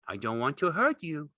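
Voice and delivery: high, earnest simple man; pleading Lenny. A narrator's reading. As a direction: explain it gently.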